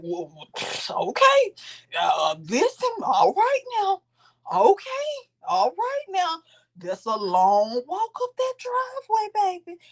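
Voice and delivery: high, drawn-out teasing singsong; mocking commentary. Bystander waiting as the argument walks up, mocking commentary. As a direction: stretch the words out high, mockingly.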